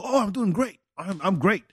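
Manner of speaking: mock excitement. Answering a how-are-you on meeting someone, mock excited. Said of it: "Why are you so excited?".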